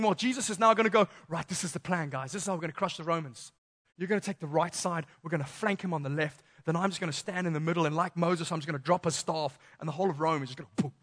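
low, quick conspiratorial plotting; scheming Peter. Retold or imitated. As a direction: sketch a battle plan in a hushed rush. Peter voiced plotting against Romans.

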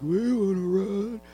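slow, lightly voiced old folks asking; a hint of a slow elderly voice; old people. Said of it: "the old people are like".

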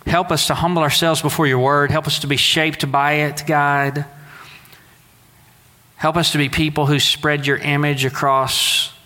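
ordinary talk

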